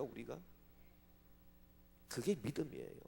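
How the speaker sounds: normal talking voice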